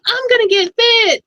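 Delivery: high, loud hammed-up enthusiasm; exaggerated squeal of determination; mock eager resolve. Acting out eager buyer's resolution.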